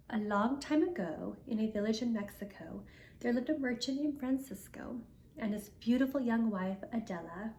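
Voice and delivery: ordinary delivery, nothing marked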